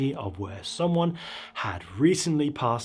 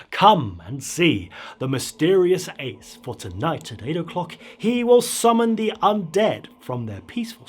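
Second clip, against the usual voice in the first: announcer poster read; 3 semitones higher, 3 dB louder than usual. Acted. Narrator reading the magician's poster to the crowd as a showman's announcement. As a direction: sell the show like a barker on a poster.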